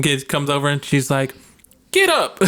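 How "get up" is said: very high sharp shout; yelled. Retold or imitated. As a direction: bark it at the kid. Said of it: "She just yelled at me".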